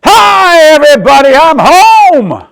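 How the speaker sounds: high, very loud call home; booming greeting. Acted. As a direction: announce yourself to the whole street. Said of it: "she turns it right up".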